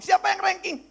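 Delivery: high-pitched call to the group; grandmother's announcement. Retold or imitated. Grandma asking grandchildren who ranked, calling out.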